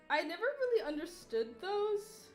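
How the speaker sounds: ordinary talk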